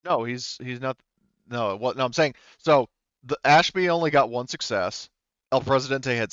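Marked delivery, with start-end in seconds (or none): none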